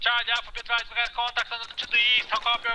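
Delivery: radio call. Acted. Radio call to Charlie Alpha.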